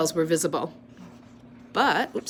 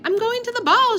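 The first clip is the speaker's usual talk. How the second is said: much higher, sing-song doll voice; high pretend voice; 5 dB louder than usual. A narrator's reading. Girl playing dolls, announcing in high pretend voice. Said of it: "she announced in a high, pretend voice".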